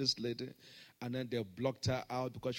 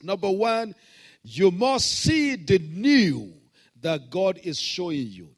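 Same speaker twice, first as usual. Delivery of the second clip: louder preaching point; 5 semitones higher, 12 dB louder, slower than usual. Acted. Pastor teaching the congregation, loud and slow. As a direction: press the lesson hard and slow.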